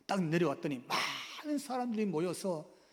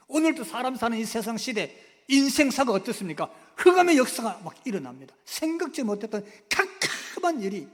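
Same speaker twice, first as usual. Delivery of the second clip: loud, driving preacher's delivery; forceful preaching; 6 semitones higher, 9 dB louder than usual. Acted. Preacher describing the seizure to the congregation, loud. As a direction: proclaim it with full force.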